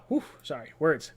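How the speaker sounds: ordinary delivery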